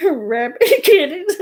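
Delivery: quoting her while cracking up; laughing retold quote; quote through laughter. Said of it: "She said".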